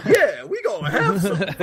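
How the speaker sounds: high, hammed-up game character with laughter; game driver impression. Podcaster imitating a driver from a taxi game, hammed-up high voice. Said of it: "B D Joe".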